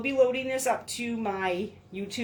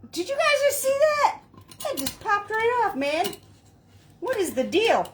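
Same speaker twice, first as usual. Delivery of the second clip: sudden high startled exclaim; surprised; 10 semitones higher, 4 dB louder than usual. Real, not acted. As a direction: caught off guard, voice shoots up. Ring pops off unexpectedly.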